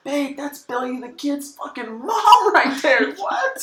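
very high, slow amazed gush; mocking a thrilled visitor at the grave, very high and slow; mock awestruck tourist. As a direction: gasp it like a starstruck tourist. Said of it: "It's like".

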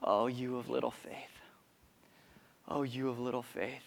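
soft, low whisper of a rebuke; gentle rebuke. Retold or imitated. Jesus calms the storm and rebukes disciples, soft whispered rebuke. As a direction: hush them tenderly. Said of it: "he says".

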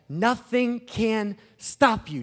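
ordinary talk, nothing marked

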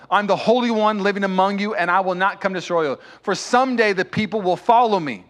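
loud, high preaching at full force; booming pulpit delivery, pleading and urgent; fiery preaching. Acted. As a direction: preach it out, building with passion.

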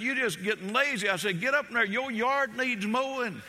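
mock stern, pitched high with a laugh; preacher teasing the husband to get up; teasing scold. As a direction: tease the patient, play the bossy one. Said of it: "I remember how he treated his wife".

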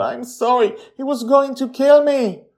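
high tearful apology; a girl sobbing out her sorry; crying girl. A narrator's reading. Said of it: "Joy immediately cries as well".

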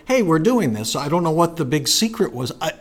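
normal talking voice

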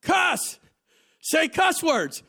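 high, loud mock shout; hammed-up crude coworker; coworker yelling. Preacher imitating a coworker urging him, high shout. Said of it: "a guy looked at me and said".

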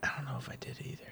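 whispered under the breath; whispering. Real, not acted. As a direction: drop to a whisper. Worried whisper about naming boss.

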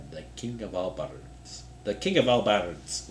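put-on quiet, botched Irish accent; bad accent attempt. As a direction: try out an Irish accent under your voice. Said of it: "Ooh, that's so bad".

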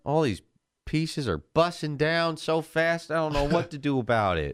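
high mock-panicked gamer.

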